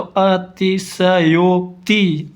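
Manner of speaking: अतिशयोक्ति said slowly, each syllable clear; teacher revealing the joined word अतिशयोक्ति; slow sounding-out. Shown as a demonstration. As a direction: lay out अतिशयोक्ति slowly for the class. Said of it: "तो यो बन जाएगा".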